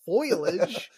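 "foliage" said as FOY-lij; mispronounced foliage. Shown as a demonstration. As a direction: echo the botched "foliage" with foil at the front. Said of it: "to pronounce foliage".

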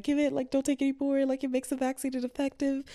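high-pitched imitation of a flustered mother; panicking mom. Mom warning daughter off ibuprofen, imitated panic. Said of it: "she started panicking".

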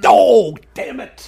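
high, loud hammed-up groan of defeat; mock exasperation. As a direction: play the director throwing up his hands. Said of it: "Ron was like".

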